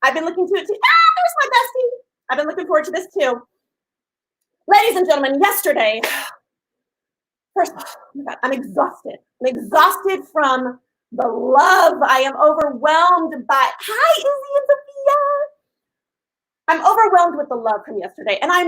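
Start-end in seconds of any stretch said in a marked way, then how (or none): 0.8-1.9 very high-pitched excited greeting; delighted squeal. Real, not acted. Host spots her bestie joining the stream, delighted squeal. 13.8-15.2 very high, sing-song hello; squeally greeting. Real, not acted. Host greets two viewers mid-sentence, high excited squeal.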